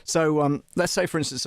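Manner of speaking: normal talking voice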